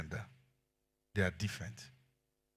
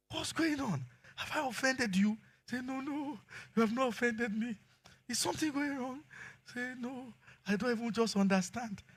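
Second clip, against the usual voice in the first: high-pitched acted exchange with the wife; lightly voiced husband and wife; high mock dialogue; 10 semitones higher than usual. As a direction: play the puzzled husband and teary wife, high. Voicing crying wife dialogue.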